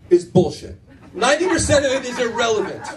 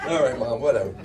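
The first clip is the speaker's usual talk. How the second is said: put-on dismissive teen; 8 semitones lower than usual. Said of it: "teen brushing off his mom".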